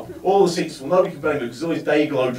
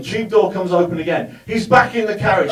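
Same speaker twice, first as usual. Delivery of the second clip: ordinary talk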